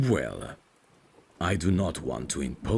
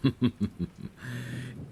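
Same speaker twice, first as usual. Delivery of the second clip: brief amused laugh, pitched up; a high little chuckle; light high chuckle; 10 semitones higher than usual. Acted. Amused at vampire remark.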